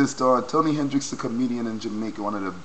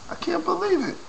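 very high squeaky amazement; disbelief. Real, not acted.

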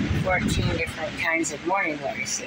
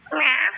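meow; 9 semitones higher, 4 dB louder than usual. Pretend. Meowing at the cat.

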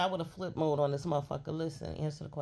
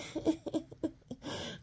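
high breathy cackle; high airy laugh at her own joke; cackling laugh; 12 semitones higher than usual. Real, not acted. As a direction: burst out cackling.

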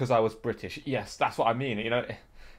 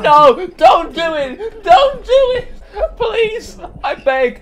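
high, loud, hammed-up pleading; mock-desperate begging; 13 dB louder, much slower than usual. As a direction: beg for your life, half laughing. Mock begging not to be shot.

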